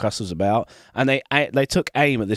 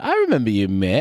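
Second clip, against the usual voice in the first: put-on Hull accent. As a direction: slip into a light Hull accent. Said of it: "go into that kind of".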